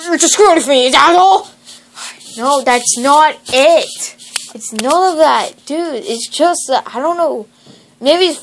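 ordinary talk, nothing marked

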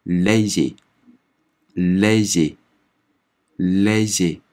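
lazy stretched very slowly; slow French demo. Shown as a demonstration. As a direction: sound out lazy syllable by syllable. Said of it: "所以读来". (极慢示范读lazy)

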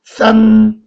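싼 held high and level; first-tone demo. Shown as a demonstration. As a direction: sustain 싼 on one high pitch. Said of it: "일 성, 싼".

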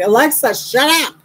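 very high mock yell at Alexa; retold exasperated yell. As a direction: cut in, voice shooting up.

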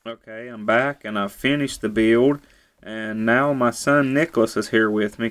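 normal talking voice

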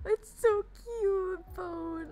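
cooing. Real, not acted. Cooing at cute gift.

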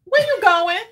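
high, loud, suspicious demand. Retold or imitated. Wife re-enacts questioning her rushing husband, demanding. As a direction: call after him wanting an answer.

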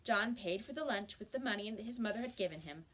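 usual speaking voice

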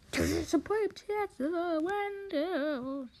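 high, slow, stretched-out words; hammed-up sing-song wail; drawn-out cry. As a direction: draw every word out high. Acted scene, drawn-out high cry.

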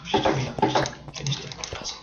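hushed urgent whisper. Real, not acted. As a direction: whisper it impatiently. Urging partner under breath.

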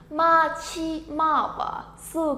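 "Machimawasu" very slow, each syllable apart. Shown as a demonstration. Teacher introducing word number six to learners, syllable by syllable. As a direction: stretch "Machimawasu" out sound by sound.

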